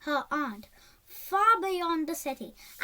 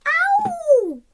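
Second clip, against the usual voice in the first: much higher, louder pained howl; ear-splitting howl; 14 semitones higher, 12 dB louder than usual. Acted. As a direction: howl in pain as the tongue is pricked. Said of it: "let out an ear-splitting howl".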